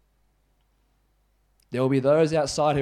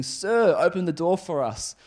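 higher-pitched urgent plea; pleading; 4 semitones higher, 5 dB louder than usual. Acted. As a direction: call out as if begging at the door. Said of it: "knocking and pleading".

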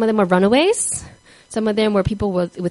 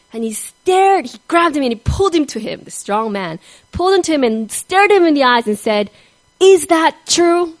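intense demand; 6 semitones higher, 6 dB louder than usual. Retold or imitated. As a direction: press him hard for the truth. Convict confronting Albert intensely.

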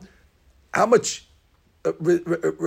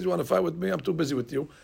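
1 quiet dismissive God; 10 dB quieter, faster than usual. Retold or imitated. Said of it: "Borei Olam says".

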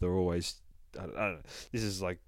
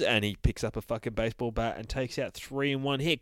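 usual speaking voice

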